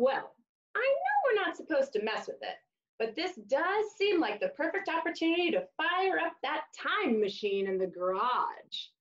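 lively storybook voice for little listeners; reading to children. Acted. Teacher reading a taco story to kids, animated storybook voice. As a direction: read "hola" book style, animated and bright. Reads picture book to children.

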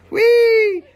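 shrieked high and loud "whee"; excited squeal. Shown as a demonstration. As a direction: squeal like a thrilled kid. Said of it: "We say".

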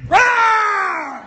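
playful monster rawr; monster roar. Pretend. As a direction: roar at the babies like a beast. Roars again.

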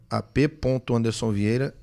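ordinary talk, nothing marked

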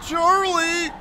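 high-pitched holler of a name; calling out. Acted. Gamer calls out to game character Charlie, mock calling. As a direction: yell out for Charlie.

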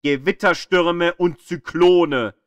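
usual speaking voice

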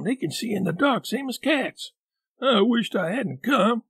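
high scared voice; fearful whining boy; frightened. Acted. Huck frightened in graveyard.

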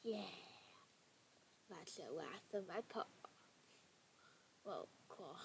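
usual speaking voice

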